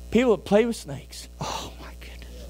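hushed, breathy aside; whisper. Acted. Preacher confiding fear of snakes, whispered aside. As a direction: drop to a secret whisper.

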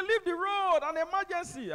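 high, loud, urgent yell; shouted order. Retold or imitated. Van driver ordering traffic aside, urgent yell. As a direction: bark at the cars to move. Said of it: "He said".

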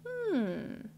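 high soft musing hum; quiet storyteller hmm; thoughtful hum. A narrator's reading. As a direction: hum as if pondering. Thinking sound.

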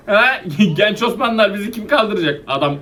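usual speaking voice

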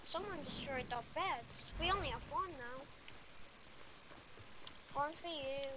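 ordinary delivery

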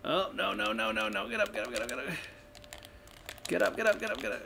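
frantic, high-pitched pleading; panicked. Real, not acted. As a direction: panic as you're caught. Caught again, frantic.

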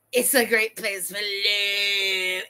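slow, creepy voiceover with drawn-out last word. Retold or imitated. Streamer mocking a vlogger's romantic voiceover, creepy voice. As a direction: mimic a creepy voiceover, stretching the ending. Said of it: "with that creepy voice".

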